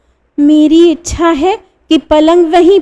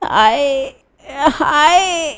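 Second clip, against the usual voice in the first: soft, crying moans; quiet tearful groan; 2 semitones higher, 5 dB quieter, much slower than usual. A narrator's reading. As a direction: whimper each word in pain. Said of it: "कराहता है".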